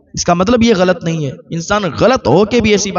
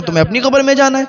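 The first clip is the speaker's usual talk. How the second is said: pitched up and quick, scoffing at him; higher, faster mockery; 8 semitones higher, faster than usual. Wife mocking her husband's nagging, higher and faster. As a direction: rattle it off high and dismissive. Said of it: "مذاق بناتی تھی، ہنستی تھی".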